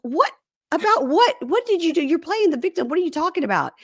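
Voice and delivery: high-pitched retold disbelief; mock incredulous. Shantae answering his accusation, acted incredulity. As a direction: fire back questions way up in pitch. Acted baffled comeback in quarrel.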